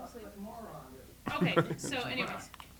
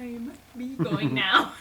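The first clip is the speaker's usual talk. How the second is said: slow, drawn-out sticky-mouthed Pooh; 4 dB louder, slower than usual. Retold or imitated. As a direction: stretch the words as if stuck with honey. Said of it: "in a very sticky voice".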